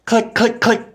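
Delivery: high, loud, sharp repeated clicks; staccato high-pitched sound effect; imitating gun clicks. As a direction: mimic a trigger clicking and misfiring. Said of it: "It went click".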